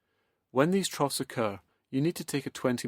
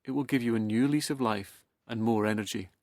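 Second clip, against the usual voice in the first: ordinary talk, nothing marked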